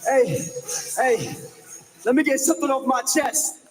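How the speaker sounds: normal talking voice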